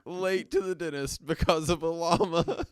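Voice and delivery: words tumbling out through laughter; laughing tease. Real, not acted. Co-host teasing friend late because of llama, laughing. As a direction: laugh along while teasing.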